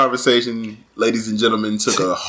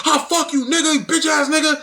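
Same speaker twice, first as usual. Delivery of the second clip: high, playful fake-angry insult; mock yelling insult; 12 semitones higher than usual. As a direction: hurl it like a friendly fake fight. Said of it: "be yelling and cursing".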